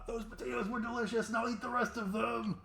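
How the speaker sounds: high, squeaky gloating from inside; a tiny villain gloating through the monster's jaws; muffled creature voice. Acted. GM voicing the creature inside the Behir as it attacks Stella, high gloating. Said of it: "it says".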